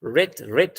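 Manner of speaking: "रेट" said alone as a syllable; isolated last syllable. Shown as a demonstration. Teacher picking out the last syllable, "रेट" said alone. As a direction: repeat "रेट" on its own, evenly. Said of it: "last syllable कौन सा है?".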